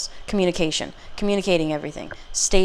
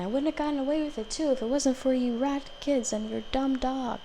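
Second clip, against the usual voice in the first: high, soft villain grumble; Scooby-Doo villain; 8 semitones higher, 5 dB quieter than usual. Retold or imitated. Foiled villain blaming the kids and their dog, grumbling. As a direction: do a quick, hushed cartoon villain's complaint. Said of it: "You know, from Scooby-Doo".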